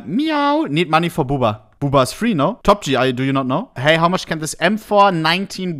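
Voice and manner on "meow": high, playful meow; cat meow. Pretend. Streamer reading a joke offer, a high playful meow. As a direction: toss out a silly little cat sound.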